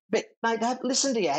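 lightly hammed-up bouncer brushing him off; mocking bouncer. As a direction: toss it off like a smug doorman. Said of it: "he's saying".

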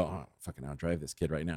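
low, soft mutter under the breath; muttered self-talk. Retold or imitated. Dad vowing to outdrive his son, muttered. Retold muttered thought to himself.

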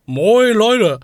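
hammed-up Hamburg greeting Moin, Leute; imitating Moin greeting. Teasing the co-host by imitating his northern greeting. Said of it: "Du sagst doch immer Moin".

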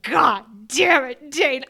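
loud, slow, gritted-teeth "God damn it, Dane"; exasperated curse. Retold or imitated. Reader gripping the book, fed up with Dane.

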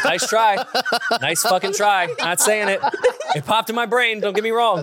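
giggling while refusing; laughing. Real, not acted.

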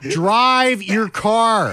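high, very drawn-out exasperated wail; exasperated driver. Acted. Telling slow turners off, exasperated wail. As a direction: stretch each word in frustration.

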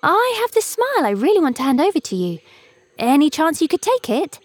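bright little bird calling out; eager cuckoo voice. A narrator's reading. Cuckoo offers smile.

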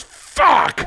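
high-pitched pretend outburst; mock cursing kid. Imagined daughter cursing alone in room, mock yell. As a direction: swear like a kid letting loose.

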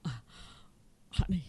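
imitating a man barely able to speak; faint, halting, almost whispered; choked up. Husband home from the game, words barely coming out, mock choked-up. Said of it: "nothing is coming out of his mouth".

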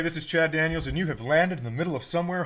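normal talking voice

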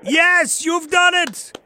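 high, loud joyful announcement; excited. Real, not acted. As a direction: cheer the winner on. Host congratulates the winning caller.

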